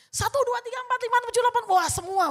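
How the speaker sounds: high, loud machine-gun count; rapid-fire outburst. Retold or imitated. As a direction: fire the numbers off like bullets. Acting out firing off complaints like gunfire. (hitungan keras memberondong seperti senapan)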